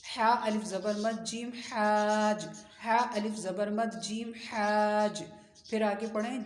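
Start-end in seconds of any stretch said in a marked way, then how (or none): none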